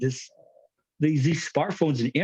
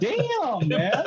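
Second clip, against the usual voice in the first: laughing mock reaction; 7 semitones higher than usual. Kid's comeback acted by dad.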